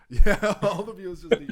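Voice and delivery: words spilling out through laughter; laughing. Real, not acted. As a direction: laugh while repeating the joke. Repeating a joke while laughing.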